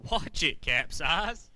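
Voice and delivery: loud sharp warning; loud scolding. Acted. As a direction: scold the captain loudly. Crewmate scolding captain loudly.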